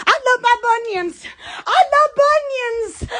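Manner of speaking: building high-pitched exclamation; excited declaration. Real, not acted. Declares it louder and higher.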